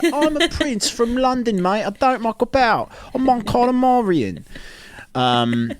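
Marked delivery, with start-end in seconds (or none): 0.0-4.4 high, slow hammed-up Cockney voice; mock London prince. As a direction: swagger like a London geezer prince. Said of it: "this guy who's like".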